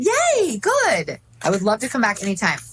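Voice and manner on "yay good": high, squealing yay; happy high-pitched celebration; delighted cheer. Real, not acted. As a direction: cheer in a much higher pitch, thrilled. Guest cheers at being invited back.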